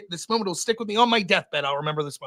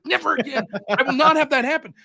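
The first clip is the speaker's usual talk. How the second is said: higher, firm retold vow; vowing angrily; 5 semitones higher, 4 dB louder than usual. Beaten debater swearing to himself, acted angry resolve. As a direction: pitch it up, louder as it goes. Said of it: "That's how mad I was".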